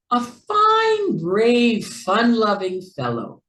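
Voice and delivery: hearty warm praise. A narrator's reading. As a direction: agree heartily and proudly. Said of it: "Jack Rabbit agreed heartily".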